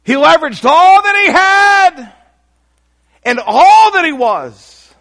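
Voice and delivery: high, loud, stretched-out cry; preaching shout. Real, not acted. Preacher shouting to the church about Mueller's sacrifice, full force. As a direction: belt it out to the congregation.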